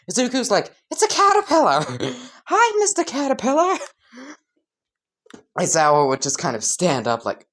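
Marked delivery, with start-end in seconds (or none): 0.9-3.8 very high, giggly delighted voice; giddy childlike excitement. Acted. Izuku says hello to his caterpillar teacher, giddy excitement. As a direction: greet it like an overjoyed little kid.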